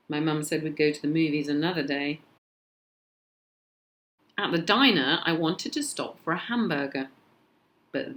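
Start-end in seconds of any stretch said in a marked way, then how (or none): none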